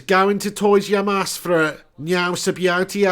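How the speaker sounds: slow, hammed-up Wolverhampton accent. Reading a viewer's comment aloud, mock Wolverhampton accent. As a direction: read it in broad mock Wolverhampton. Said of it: "Wolverhampton accent".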